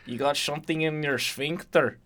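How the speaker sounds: hammed-up Russian-accented teasing; mock Russian accent. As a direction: tease Victor in a joke Russian accent. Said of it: "It's Russian".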